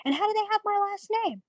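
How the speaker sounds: high-pitched outraged protest; retold indignation. Telling brother about elders' false claim, indignant protest.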